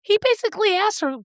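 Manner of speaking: ordinary delivery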